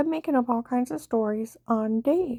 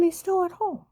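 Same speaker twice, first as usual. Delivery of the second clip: high insistence; 7 semitones higher than usual. Retold or imitated. Insisting her husband never left.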